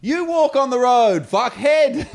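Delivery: high, loud, slow snappy retort; mock snapping cyclist. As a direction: play a cyclist firing straight back. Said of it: "she snapped back".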